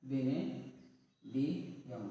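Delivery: Vanadium slowly drawn out like dictation; drawn-out teacher voice. Shown as a demonstration. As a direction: stretch vanadium very slowly, syllable by syllable. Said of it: "क्या हो जाएगा?".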